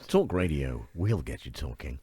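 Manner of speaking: low, quiet announcer voice; deep voiceover voice. Shown as a demonstration. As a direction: drop low like a movie trailer. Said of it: "That's really good".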